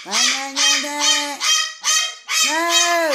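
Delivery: squeaky high no, no, no; mock bird-voice scold; very high pet reprimand; high-pitched scolding. Real, not acted.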